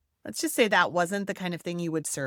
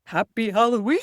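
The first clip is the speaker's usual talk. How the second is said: put-on a louder, singsong Happy Halloween to a baby; playful baby talk; 3 semitones higher, 7 dB louder than usual. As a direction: coo it at a giggling baby. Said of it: "we would go".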